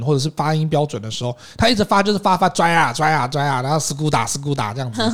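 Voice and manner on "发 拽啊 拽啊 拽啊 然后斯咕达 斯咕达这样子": mangled English sounds mocked; 学不标准英文发音的模仿; mock bad English. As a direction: imitate a learner mangling English words. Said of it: "没有办法一直讲标准的英文".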